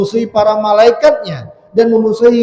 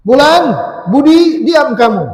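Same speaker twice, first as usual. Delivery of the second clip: teacher singling out pupil; 3 semitones higher, 5 dB louder than usual. Acted. Teacher naming one noisy pupil, loud acted scolding. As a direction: call out sharply like a teacher. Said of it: "Lalu dia bilang, menyebut satu nama".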